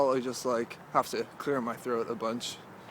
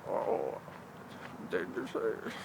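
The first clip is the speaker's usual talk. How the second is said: put-on old person voice; 8 semitones lower, 6 dB quieter, slower than usual. Shown as a demonstration. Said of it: "I'll be proud of that voice".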